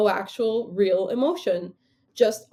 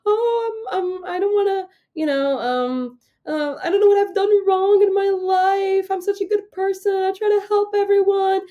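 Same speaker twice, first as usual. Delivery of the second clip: high, whiny mock lament, higher; mocking mother's self-pity; 11 semitones higher, 4 dB louder than usual. Daughter mimics mother's teary self-pity, high whiny mock. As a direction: ham up a self-pitying wail. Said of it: "suddenly she would be like".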